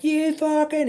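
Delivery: very high-pitched mock scream; strained burst of retold rage. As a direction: imitate screaming at a phone. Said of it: "he's, like, screaming at his phone".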